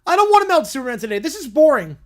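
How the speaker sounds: put-on loud, fast whiny child voice; whiny complaining kid. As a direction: whine it like a bored toddler. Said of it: "Little Bear complains the whole time".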